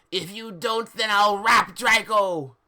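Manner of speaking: high, loud slow villain shout; hammed-up menacing yell; villain threat. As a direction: bellow the threat like a cartoon villain. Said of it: "he said to me".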